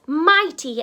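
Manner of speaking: ordinary delivery, nothing marked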